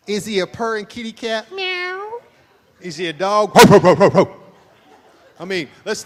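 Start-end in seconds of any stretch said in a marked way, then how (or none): 1.5-2.2 high playful meow; cat meow. Pretend. Pastor asking wives if husband is a kitty cat, high meow. 3.5-4.3 loud playful ruff ruff; dog barking. Pretend. Pastor asking wives if husband is a dog, loud barking.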